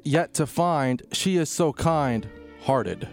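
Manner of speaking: usual speaking voice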